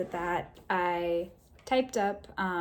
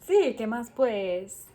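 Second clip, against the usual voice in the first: slow Paisa singsong, louder; Paisa accent; 4 semitones higher, 9 dB louder, slower than usual. Shown as a demonstration. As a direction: stretch it with Paisa lilt. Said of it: "very unique intonation where it's like".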